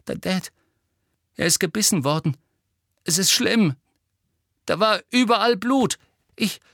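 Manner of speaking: urgent, high, rising in volume; panicked. Acted. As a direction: force out the terrible news with mounting panic. Boy reports attack in panic. (panisch, immer dringlicher)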